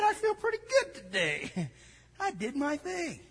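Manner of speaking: smug self-satisfaction. Retold or imitated. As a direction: play the self-satisfied believer, lightly. Smug churchgoer voiced.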